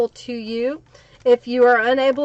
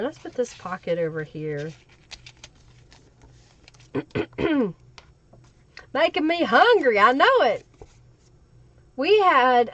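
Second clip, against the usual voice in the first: usual speaking voice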